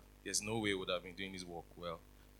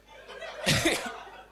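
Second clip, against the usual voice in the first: embarrassed laugh; 6 dB louder than usual. Real, not acted. Laughing at own emotion.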